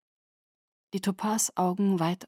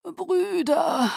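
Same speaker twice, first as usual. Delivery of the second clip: gloomy; 11 semitones higher than usual. A narrator's reading. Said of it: "sagte Aro düster".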